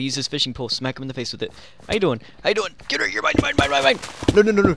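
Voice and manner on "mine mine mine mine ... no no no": excited. Real, not acted. Attacking enemy in game.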